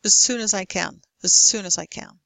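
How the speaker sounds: "As" reduced to uz; "as soon as I can" with weak, quick "as"; weak form of as. Shown as a demonstration. Teacher showing the weak form of as in a phrase. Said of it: "you may say uz".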